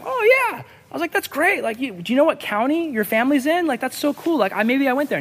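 high, gushing interest; hammed-up delight at the neighbor's news; eagerly friendly. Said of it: "So he's being friendly".

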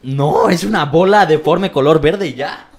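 loud slow laugh-filled complaint; amused loud exasperation. Real, not acted. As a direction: crack up describing it. Laughing at ugly piñata.

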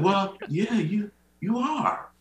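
high-pitched, drawn-out hedging; reluctant agreement. Retold or imitated. Grandchild answering grandmother about her size, hesitant mock agreement.

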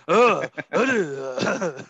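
loud imitated grunts; mock martial master grunting, forceful; Japanese master impression. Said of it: "Al Val's impression of a Japanese master".

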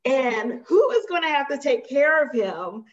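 high, laughing exasperation; laughing at the irony. Retold or imitated. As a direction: laugh through the disbelief. Laughing at fiancé's covid.

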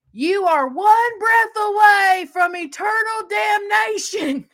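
high, slow, loud mock hellfire preacher; street preacher yell. Mimicking street evangelist yelling at sports fans, loud and high. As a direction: bellow it like a sidewalk evangelist. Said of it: "somebody yelled out".

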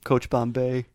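usual speaking voice

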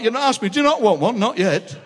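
usual speaking voice

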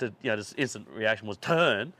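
normal talking voice

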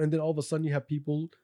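usual speaking voice

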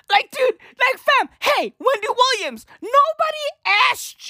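high, pleading call to her; high exasperated hey. Real, not acted. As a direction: throw your hands up at her. Calling out Wendy Williams.

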